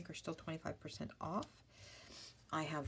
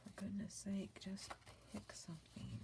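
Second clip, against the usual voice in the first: whisper; 5 semitones higher, 9 dB quieter than usual. Retold or imitated. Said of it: "You're like".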